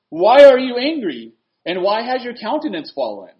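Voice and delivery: preacher thundering a scripture question; forceful confrontation. Acted. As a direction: read it out as God challenging Cain, loud and stretched. Reads God confronting Cain forcefully.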